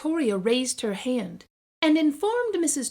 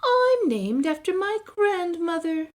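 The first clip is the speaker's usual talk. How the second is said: put-on high, louder proud child voice; smug boast; 8 semitones higher, 6 dB louder than usual. Victoria bragging to the class, high and proud. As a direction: show off proudly.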